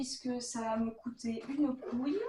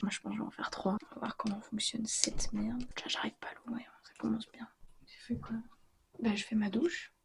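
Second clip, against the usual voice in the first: whispering; 2 semitones lower, 4 dB louder than usual. Real, not acted. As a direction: whisper it so nobody wakes. Keeps whispering at night.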